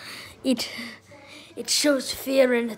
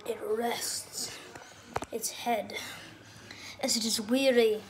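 hushed documentary narrator whisper; nature documentary; 4 semitones lower, 7 dB quieter than usual. Pretend. Dad narrates dog like documentary.

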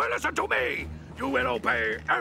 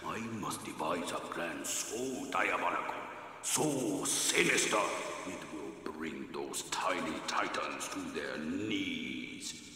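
much softer and lower, plotting under his breath; scheming quietly; 12 semitones lower, 8 dB quieter than usual. Acted. Villain alone in his lair, plotting in a low hushed voice. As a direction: mutter the evil plan to yourself, low and hushed.

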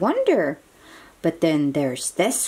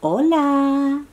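high, louder little crab greeting; a playful high crab saying hola; 8 semitones higher, 3 dB louder than usual. Acted. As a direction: chirp it out like a cheerful crab. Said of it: "this crab saying".

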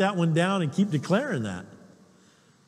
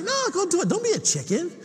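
teasing wife; 10 semitones higher, faster than usual. Retold or imitated. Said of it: "And she's like".

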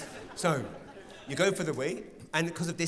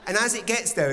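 usual speaking voice

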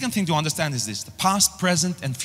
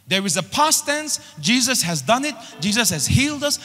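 full-force preaching; 5 semitones higher than usual. Acted. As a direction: lift the congregation with rising fervour.

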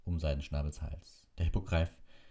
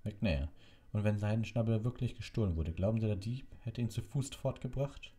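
raised, lightly cheerful questioning; quite amused; 6 semitones higher than usual. A narrator's reading. Dumbledore doubting the executioner's theory, amused. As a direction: tease gently, pitched up. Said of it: "seine Stimme klang recht vergnügt".

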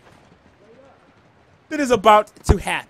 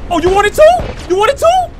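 high mocking taunt; 13 semitones higher, 5 dB louder than usual. Real, not acted. Taunting enemy player.

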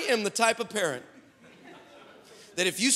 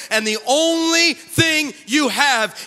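loud, raised, driving sermon climax; full-force preaching; 4 semitones higher, 7 dB louder than usual. Real, not acted. Preacher telling congregation how breakthrough happens, full-force preaching. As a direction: build and hammer each phrase out.

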